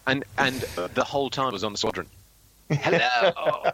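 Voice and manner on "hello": hammed-up British hello, pitched high; George Mason. Shown as a demonstration. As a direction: swoop through it in a theatrical British voice. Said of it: "an Eddie Izzard doing George Mason voice".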